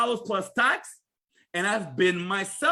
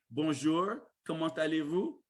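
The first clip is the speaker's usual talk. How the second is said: French accent, softer and lower; 6 semitones lower, 6 dB quieter than usual. Shown as a demonstration. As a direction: greet in a soft French accent, questioning lilt. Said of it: "I'm saying with the accent".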